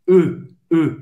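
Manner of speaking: "E" said through lips rounded for O. Shown as a demonstration. Teacher demonstrating the French E sound, lips rounded. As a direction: shape O, then say "E". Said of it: "faz a boca do O e fala E".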